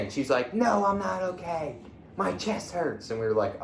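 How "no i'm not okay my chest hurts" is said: higher, pained woman's voice; injured woman. Retold or imitated. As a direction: play the hurt driver, voice pitched up. Injured woman voiced after crash.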